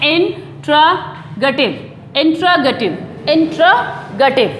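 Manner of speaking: "इंट्रोगेटिव" slowly, in... tra... ga-tiv; "इंट्रोगेटिव" syllable by syllable for learners to copy; correct pronunciation. Shown as a demonstration. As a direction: break "इंट्रोगेटिव" into drawn-out chunks. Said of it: "कैसे अच्छे तरीके से इसको बोल सकते हैं".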